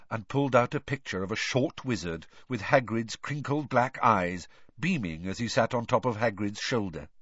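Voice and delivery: ordinary delivery, nothing marked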